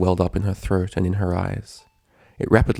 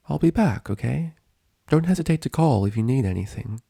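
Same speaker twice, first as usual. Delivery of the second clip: gentle nurse; 6 semitones higher than usual. A narrator's reading. Nurse voiced higher.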